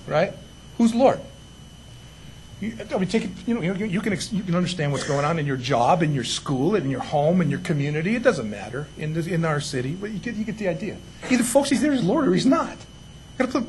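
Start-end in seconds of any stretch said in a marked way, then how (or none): none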